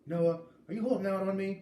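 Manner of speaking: voiced as a pushy little sister. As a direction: needle your big brother for the secret. Little sister pressing her brother.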